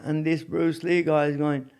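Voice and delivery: ordinary talk, nothing marked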